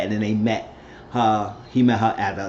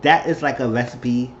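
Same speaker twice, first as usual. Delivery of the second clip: ordinary talk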